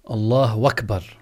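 أكبر pronounced wakbar after الله; hamza turned waw. Shown as a demonstration. Teacher shows another invalid takbir, saying wakbar. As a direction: replace the opening of أكبر with "wa": allah wakbar. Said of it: "أيضًا كذلك لو قال".

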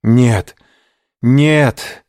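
high, loud anguished no; desperate cry. A narrator's reading. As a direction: cry out in despair. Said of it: "воскликнул тот".

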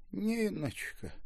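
high, slow, weak moan; shaken moan. A narrator's reading. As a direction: moan it faintly in shock. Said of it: "потрясённо простонала старушка".

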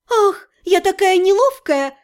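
high, sugary mock sorry; fake innocent. A narrator's reading. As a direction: play innocent sweetly. Mock apology after stomping him.

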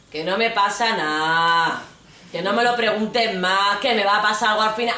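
put-on annoyed boyfriend losing patience; irritated boyfriend. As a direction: drag it out, getting angry.

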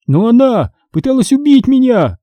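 high-pitched whiny protest; whining. A narrator's reading. Boy complaining to parents, high whine. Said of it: "заныл я".

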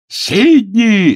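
loud, high, drawn-out call; yell. A narrator's reading. Agnes summons Sidney across the golf course, yelling. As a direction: bellow the name at high pitch. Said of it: "заорала она".

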